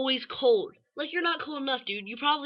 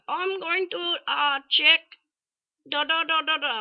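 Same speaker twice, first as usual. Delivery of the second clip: high, loud mock Indian accent; put-on Indian English, pitched up; 7 semitones higher, 5 dB louder, slower than usual. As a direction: imitate a doctor with an exaggerated Indian accent. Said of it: "this is how he talked".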